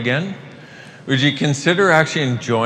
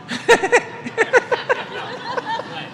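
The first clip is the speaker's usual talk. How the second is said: high helpless laugh at her own joke; cracking up for real; real laughter; 3 dB quieter than usual. Real, not acted. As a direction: burst of giggling at own punchline. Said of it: "I'm sorry, that's so funny".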